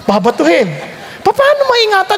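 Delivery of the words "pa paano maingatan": high, laughing question; preacher mocks a promise to protect, laughing high; laughing disbelief. Real, not acted.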